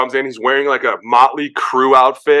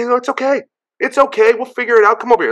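reassuring teammate; 12 semitones higher, faster than usual. Retold or imitated. Geno calming him after mistakes.

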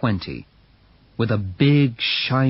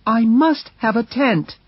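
high, firm lady's voice; wife refusing to give up the tent, high; insistent Mercedes; 11 semitones higher, 3 dB louder than usual. Acted. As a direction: insist on it, pitched up. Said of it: "she answered".

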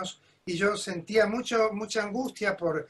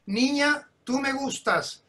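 imitated grande dame halting someone, louder then higher; Doña Lola; 3 semitones higher, 3 dB louder than usual. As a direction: cut her off imperiously, then pronounce approval. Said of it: "apareció Doña Lola como una reina". (gran diva imitada, imperiosa y más aguda)

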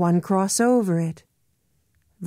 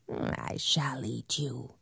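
whispered threat; 6 semitones lower, 13 dB quieter than usual. Acted.

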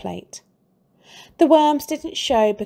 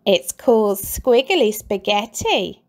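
sly Mrs Twit; 5 dB louder than usual. A narrator's reading.

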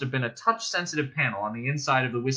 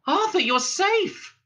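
high, drawn-out joyful cry; excited cry; 4 dB louder, slower than usual. A narrator's reading. A voice calls out to Arthur as he enters, crying out. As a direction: cry it out high, slow and loud. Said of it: "a voice cried".

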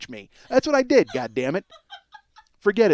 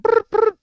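high, louder mock ringing buzz; a playful vocal phone buzz; imitated phone buzz; 5 dB louder than usual.

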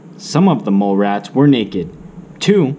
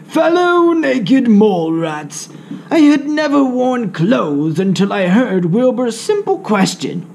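proclaiming Grandpa; 10 semitones higher than usual. Acted. Grandpa addressing the colony about clothes, grand proclamation. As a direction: declaim to the whole colony.

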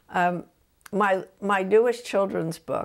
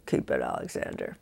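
muttered grudging give-in, much softer; 5 dB quieter than usual. Retold or imitated. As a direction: mumble it like a sulky kid conceding. Son grudgingly gives in.

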